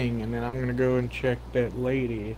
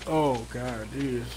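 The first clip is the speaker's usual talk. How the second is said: ordinary delivery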